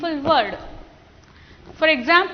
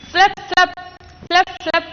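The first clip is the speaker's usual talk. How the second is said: wing flaps; 4 semitones higher than usual. Shown as a demonstration. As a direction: flap flap like beating wings. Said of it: "Repeat after me".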